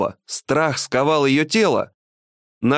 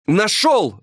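higher-pitched triumphant exclamation; joyful cry; 7 semitones higher than usual. A narrator's reading. Geologist finding what he sought, joyful high cry. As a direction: call out the find with delight. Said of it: "радостно закричал он".

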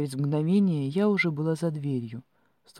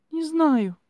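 high, loud wailed denial; helpless high-pitched cry; tearful wail; 12 semitones higher, 8 dB louder than usual. A narrator's reading. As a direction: wail it on the edge of tears. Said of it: "По щекам опять потекли слёзы".

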